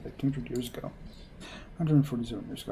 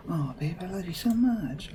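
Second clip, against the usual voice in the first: higher, slow, syrupy lovestruck coo; gushing lovebird; 5 semitones higher than usual. Acted. Girlfriend coos at her boyfriend, gushing and sappy. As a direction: drip with sappy affection. Lovey-dovey couple behind you.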